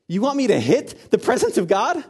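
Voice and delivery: high, loud, incredulous question; appalled disbelief. Acted. Moses questions God's order to strike his presence, appalled disbelief. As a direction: balk at an unthinkable order, voice leaping up. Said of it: "the confusion and the terror that Moses must have felt".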